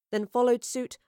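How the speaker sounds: plain reading voice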